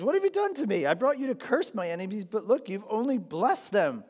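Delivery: betrayed king scolding the prophet; king Balak bursting out that his enemies were blessed; exasperated outburst. Retold or imitated. Balak says.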